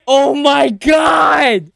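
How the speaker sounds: slow, high, stretched exclamation; real awestruck holler; amazed shout. Real, not acted. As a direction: drag it out in amazement. Amazed by fight on screen.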